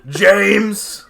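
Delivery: loud shouted call, high and loud; angry-sounding shout of a name; yelled name. Retold or imitated. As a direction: bellow his name across the room. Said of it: "you sounded fucking angry".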